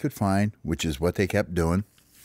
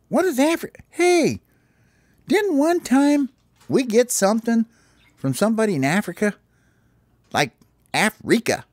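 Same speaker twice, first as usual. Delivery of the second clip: high puzzled bird voice; 14 semitones higher than usual. Acted. Bird puzzling over a word.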